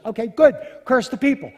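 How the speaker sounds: very loud, high, emphatic command; impatient shouted order. Acted. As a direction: boss him about at full volume. Balak impatiently orders Balaam to curse.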